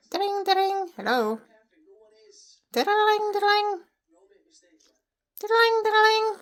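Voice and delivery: high, loud sing-song ding ding; mock phone ring. Owner imitating a phone ring to her parrot, high and loud. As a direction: ring like a telephone for the bird.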